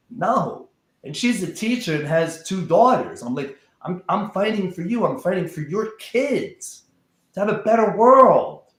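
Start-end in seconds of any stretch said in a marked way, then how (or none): 3.8-8.7 higher-pitched, rising insistence, louder at the end; impassioned plea. Retold or imitated. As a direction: plead your cause with heat. Retelling own plea to stepdaughter.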